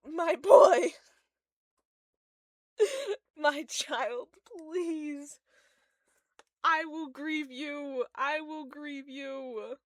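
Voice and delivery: high, hammed-up mourning wail; mock grief. Fan promising to grieve a character, mock anguished. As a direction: lament your child, loud at first, then drawn out.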